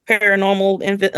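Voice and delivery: usual speaking voice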